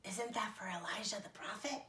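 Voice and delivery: soft whisper. A narrator's reading. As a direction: lean in and whisper. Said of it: "Abigail whispered".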